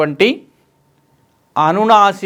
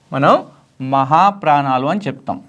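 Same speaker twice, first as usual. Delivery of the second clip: usual speaking voice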